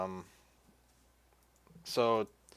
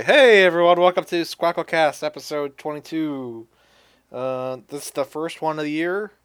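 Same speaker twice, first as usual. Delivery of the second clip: energetic restart; 3 semitones higher, 8 dB louder than usual. Real, not acted. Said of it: "Just start over from this".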